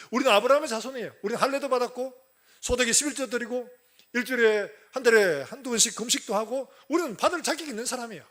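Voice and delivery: high, loud boasting in character; self-righteous Pharisees. Retold or imitated. Pharisees and scribes boast they differ from sinners, haughty loud declaiming. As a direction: proudly list your credentials, looking down on others. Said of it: "바리새인과 서기관들은 어때요?".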